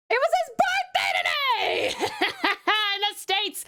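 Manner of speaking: high, laughing birthday shout; excited announcement. Real, not acted. Sister outing her brother's birthday to chat, excited announcement. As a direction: blurt it out high through laughter.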